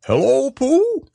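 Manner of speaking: high, drawn-out greeting. A narrator's reading. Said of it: "And Owl looked out".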